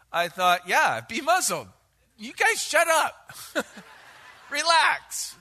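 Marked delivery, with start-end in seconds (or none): 0.7-3.3 snappy, higher and louder telling off; playful hammed-up rebuke; mock scolding. Imagined Jesus scolding disciples. 3.6-5.4 laughing. Real, not acted.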